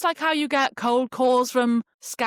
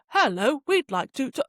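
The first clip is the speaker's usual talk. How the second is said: automated voice message; 4 semitones higher than usual. Retold or imitated. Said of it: "It's like an automated voice message".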